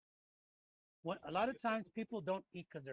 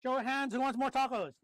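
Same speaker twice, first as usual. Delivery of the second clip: loud, high call to the room; 7 semitones higher, 9 dB louder than usual. Real, not acted. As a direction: call out to the whole table. Host calling out to the table.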